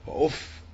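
وأف said wa-uff, ending on a bare f; وأف with u on the hamza, f unvowelled; damma, silent ending. Shown as a demonstration. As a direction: give أف an u vowel and stop on the f. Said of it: "بضم الهمزة وإسكان الفاء".

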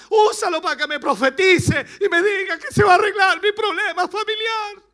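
high, loud imitated pleading to God; pleading prayer. Believer begging God for a prophecy, imitated high and loud.

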